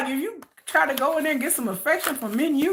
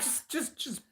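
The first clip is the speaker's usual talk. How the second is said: voiceless hushing; shushing; 18 dB quieter, slower than usual. Retold or imitated. [name] shushing his girlfriend while he works. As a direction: hush her quickly. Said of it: "telling you to shush".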